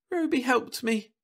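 put-on high, excited child's chatter; a girl rushing out her story; babbling little girl. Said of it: "began babbling at her".

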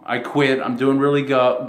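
ordinary talk

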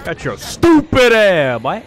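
loud, slow taunting yell; gloating shout. Real, not acted. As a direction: gloat over the kill. Gloating at a kill.

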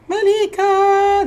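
long drawn-out call, high. Real, not acted. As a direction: call her name across the room. Host calls guest onto stream.

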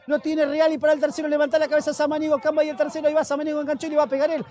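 ordinary talk, nothing marked

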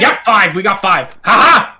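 loud high triumphant cheer. Real, not acted. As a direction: crow with victory. Celebrating EMF five.

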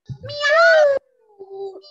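high, loud "¡Miau!"; a triumphant cat cry in the forest; loud cat meow. Acted. Cat in the story.

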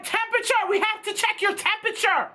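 high, urgent demand about temperature; insistent nail tech. Retold or imitated. Nail tech urgently telling them to check temperature. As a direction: press them to take temperature. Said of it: "And she's like".

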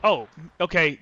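ordinary talk

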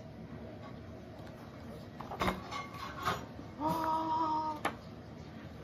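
long breathy gasp of horror; shocked gasp. Acted. Olivia seeing the dog with her toy, long gasp. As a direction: suck in air at the terrible sight.

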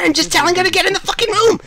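exasperated shouted defence; exasperated retort. Pretend. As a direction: fire back quickly and loudly. Hostage snaps back at captors.

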